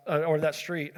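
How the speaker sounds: normal talking voice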